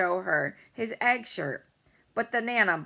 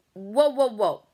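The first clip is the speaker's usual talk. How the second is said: sharp, loud stopping call; loud whoa; 3 semitones higher, 8 dB louder, slower than usual. A narrator's reading. Boy stopping the pushy girl with a loud whoa. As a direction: halt her with a loud whoa.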